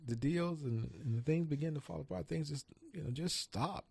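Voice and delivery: ordinary talk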